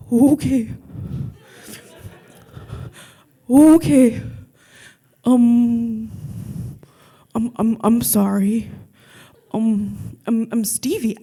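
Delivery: stammering dopey thug backing down; lightly voiced dumb thug. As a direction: dim tough guy caving in. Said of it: "it's my dumb thug voice".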